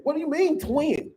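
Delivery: normal talking voice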